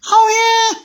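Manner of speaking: very high, squeaky "Oh, yeah?" dare; squeaky taunting toy. Pretend. One toy squeaking a dare at the train. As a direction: squeak it out like a cheeky little toy picking a fight. Toys quarrel in pretend play.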